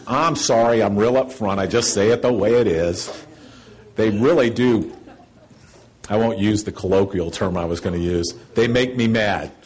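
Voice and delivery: ordinary talk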